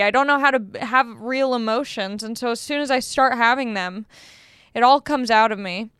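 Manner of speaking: ordinary delivery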